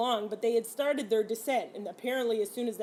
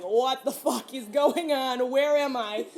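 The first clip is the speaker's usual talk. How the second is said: slow, louder, half-laughing what-the-hell; shocked confusion; 4 semitones higher, 6 dB louder, slower than usual. Retold or imitated. Waking on the plane floor, asking what's going on in laughing shock. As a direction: wake up baffled and blurt it out.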